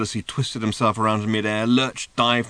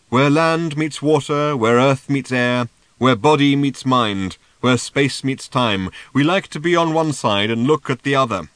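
slow and louder, like an oracle; slow solemn pronouncement; 2 semitones higher, 7 dB louder than usual. A narrator's reading. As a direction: intone each line slowly and grandly. Seer's profound pronouncement.

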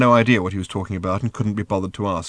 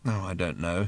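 weary; 2 semitones lower, 7 dB quieter than usual. A narrator's reading. Arthur humouring Ford's tale, weary. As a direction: let it drop, tired out. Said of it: "said Arthur wearily".

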